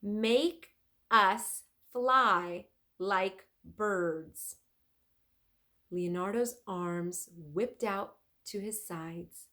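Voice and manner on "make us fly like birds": very slow, loud, clear command; counting words out loud and clear. Acted. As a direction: spell out each word like a spell. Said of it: "in a loud, clear voice".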